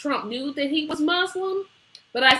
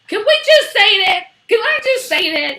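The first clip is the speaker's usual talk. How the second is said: excited; 11 semitones higher, 7 dB louder than usual. Real, not acted. Excited outburst.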